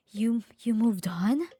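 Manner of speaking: slow shocked whisper; whispered disbelief. Acted.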